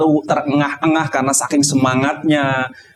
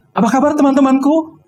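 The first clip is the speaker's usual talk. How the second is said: cheerful greeting; 7 semitones higher than usual. Shown as a demonstration. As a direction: greet the audience with energy. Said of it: "jangan lupa tanyakan kabar". (sapaan penuh semangat)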